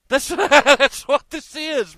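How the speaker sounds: loud, high burst of laughter in the words; laughing. Real, not acted. Man wrapping up his rant about a simple scam, laughing. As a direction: laugh out loud as you sum up.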